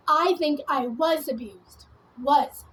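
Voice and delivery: slow, deliberate emphasis on "was"; stress on was. Real, not acted. Survivor insisting she was abused, stressing "was". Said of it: "Emphasis on the word was".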